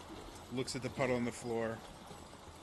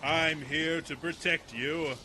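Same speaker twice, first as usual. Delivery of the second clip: slow, higher protector voice; blustering group leader; 4 semitones higher, 4 dB louder, slower than usual. Acted. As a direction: draw it out with stagy bravado. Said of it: "he sees who it is, is like".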